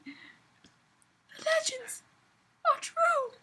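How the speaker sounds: very slow, high breathless whisper; gasped. Acted. Elf woman staring at Tony and Loki, gasped whisper. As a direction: gasp the words out in awe. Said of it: "she gasped".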